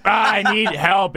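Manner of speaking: slow, high mock drunken plea; drunk girl. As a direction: wail it slowly like someone helplessly drunk. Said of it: "that fucking drunk girl".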